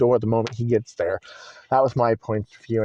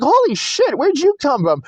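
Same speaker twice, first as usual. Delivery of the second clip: high-pitched startled exclamation; 13 semitones higher, 3 dB louder than usual. Retold or imitated. Teller finds uncle suddenly in the door, high startled cry. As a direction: blurt it out in shock.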